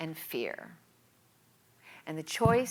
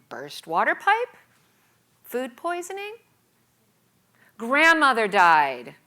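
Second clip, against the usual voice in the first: louder, trying out excuses one by one; scheming excuses; 6 semitones higher, 11 dB louder than usual. Retold or imitated. Inventing an excuse to skip work, mock scheming. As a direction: pitch each fake excuse to yourself.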